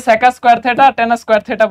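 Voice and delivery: sec said as "seka", tan said as "tana" before square. Shown as a demonstration. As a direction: add an a after sec and tan. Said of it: "এই কারণে সেকা square theta বলি".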